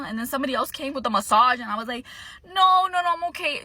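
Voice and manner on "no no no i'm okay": high-pitched mock-panicked no; panicked refusal. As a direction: fend off a pushy vendor, loudly. Retells refusing pushy massage vendor.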